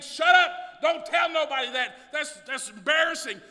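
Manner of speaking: loud, high shouted mock devil taunt; voicing the devil. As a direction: snarl it at the church as the devil. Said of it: "The devil is saying".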